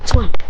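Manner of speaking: sharp, loud, high thwap; one sudden burst of sound for a snapping branch; imitated branch snap. A narrator's reading. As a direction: make the word crack out loud.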